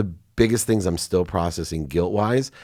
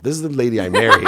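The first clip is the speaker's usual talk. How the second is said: louder, higher, real laughter in the words; laughing; 6 semitones higher, 9 dB louder, faster than usual. Real, not acted. Speaker telling how his wife replied, laughing. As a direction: talk through a burst of laughter.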